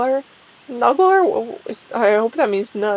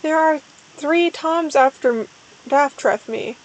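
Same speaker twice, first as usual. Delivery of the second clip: usual speaking voice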